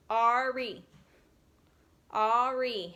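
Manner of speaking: "Ari" drawn out in two beats, repeated; slow ar-ee for "Ari"; split into syllables. Shown as a demonstration. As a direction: clap out "Ari". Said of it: "two syllables".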